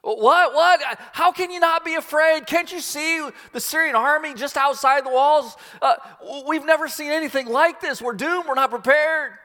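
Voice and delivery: high, loud frantic panic; frantic disbelief. Retold or imitated. Panicked servant argues with calm Elisha, high and loud. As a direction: beg for an answer in rising alarm.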